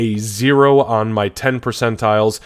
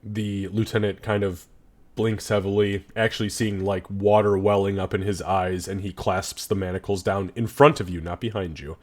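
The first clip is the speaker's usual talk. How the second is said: ordinary talk, nothing marked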